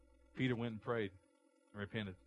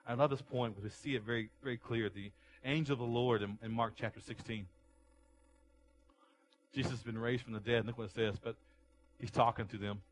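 ordinary talk

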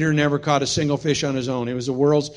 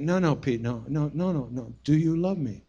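soft insistence; 6 dB quieter than usual. Retold or imitated. Jesus pressing Peter.

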